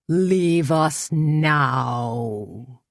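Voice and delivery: very slow, drawn-out threat; menacing order. A narrator's reading. The cult leader orders the women to leave, slow. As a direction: spell each word out coldly.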